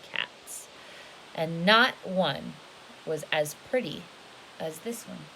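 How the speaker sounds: lively storybook read, rising and falling; storybook voice for a little one; reading to children. A narrator's reading. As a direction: read it big and animated for a child.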